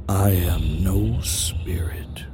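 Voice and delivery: slow, breathy ancient whisper; whispering beast. Acted. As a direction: hush it like something very old. The beast's first words.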